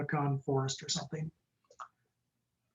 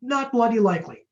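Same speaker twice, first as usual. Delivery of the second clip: lightly attempted Cockney, higher and louder; a hint of Cockney, pitched up; attempted Cockney accent; 6 semitones higher, 5 dB louder than usual. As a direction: try a quick mock-Cockney refusal. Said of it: "that Cockney accent for me".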